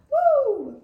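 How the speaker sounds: loud, high whoop of delight; excited whoop. Real, not acted. Reacting to the song, a loud high excited whoop. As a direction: let out a big happy woo.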